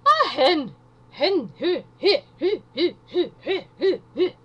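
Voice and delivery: high, loud imitation of a Scottish woman's "hen, hu, hu"; hammed-up Scottish accent; Scottish lady impression. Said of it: "speaking to this Scottish lady".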